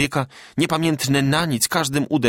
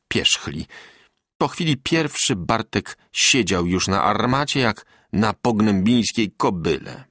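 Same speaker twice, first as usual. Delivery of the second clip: ordinary delivery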